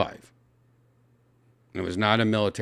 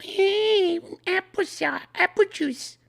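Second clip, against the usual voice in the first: very high imitating a little kid; baby voice; much slower than usual. Mimicking the boy's little baby voice, very high. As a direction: squeak it like a small child. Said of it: "his little baby voice".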